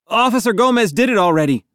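high, quick protest; urgent objection. A narrator's reading. Boy stopping officer searching his pack, urgent objection.

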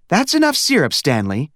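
high motherly warning; a mother stopping her son's syrup pour; gently scolding mother; mother's voice. Acted.

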